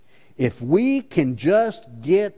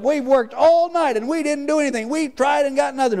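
high, loud whining complaint; high-pitched mock sulk; whiny Peter; 11 semitones higher, 3 dB louder than usual. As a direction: whine like a put-upon child dodging chores. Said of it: "he said".

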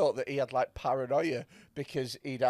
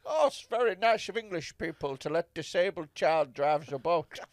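badly faked Dutch captain's accent; unplaceable mock-Dutch accent. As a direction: do a muddled foreign accent for the Dutch skipper. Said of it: "What accent was that?".

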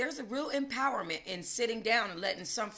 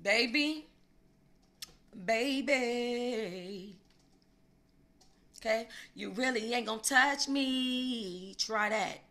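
defiant dare; 2 semitones higher, slower than usual. Acted. Daring her to touch.

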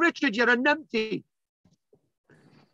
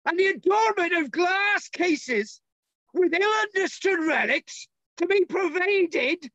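higher-pitched, put-on Dorset accent; 4 semitones higher than usual. Lecturer reading Charles Warren on desecrated monuments, Dorset accent. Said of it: "a Dorset accent".

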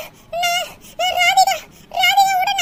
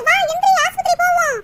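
ordinary delivery

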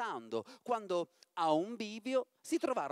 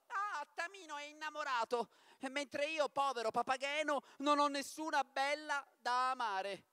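higher, lightly complaining Papageno; mild lament; 7 semitones higher than usual. Acted. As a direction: moan about having no sweetheart. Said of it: "si lamentava". (lamento leggero, voce più acuta)

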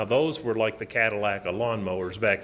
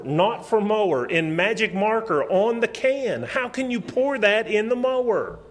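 high, loud exasperated scolding of his son; furious dad berating his son about the mower; retold angry yelling; 9 semitones higher, 4 dB louder than usual. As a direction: yell it at the boy in disbelief. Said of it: "man, I was mad".

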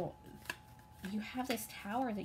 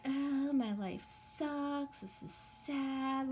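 slow, higher, whiny put-on moan; mock whiny self-pity; 5 semitones higher, much slower than usual. Reader mocking viewers who cry over spilled milk, slow whine. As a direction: drag it out like a sulking sad sack. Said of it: "and you're like".

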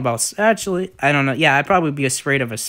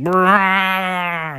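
rolled lip trill creature call; imitated dinosaur call; 5 semitones higher than usual. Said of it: "went like".

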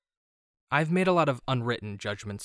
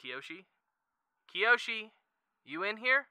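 tentative call, lifting higher; searching call; 9 semitones higher, 4 dB quieter than usual. Acted. Friend looking for Kyoshi in a room, searching call. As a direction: call out questioningly for someone unseen.

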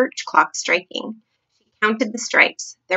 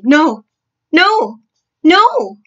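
rising, louder, higher screams; each no higher and more frantic; sleep talking; 7 semitones higher, 9 dB louder, much slower than usual. Acted. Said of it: "a slightly blurred sleep talker's voice".

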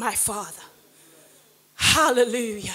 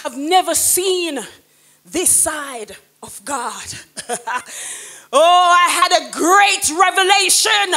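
loud, higher, ringing sermon voice; full-force preaching; 5 semitones higher, 4 dB louder than usual. Real, not acted. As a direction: preach it out to the whole church. Preaching testimony to the congregation.

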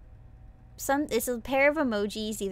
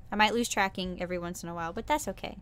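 usual speaking voice

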